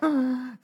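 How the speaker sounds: sharp high gasp of fright; imitated kids' gasp. Pastor acting out his kids seeing leprosy, a sudden high gasp. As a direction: gasp like a startled child.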